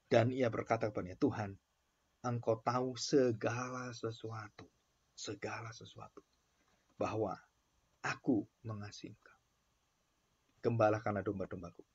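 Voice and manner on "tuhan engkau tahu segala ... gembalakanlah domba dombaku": tender reply. Acted. Reading Peter's reply.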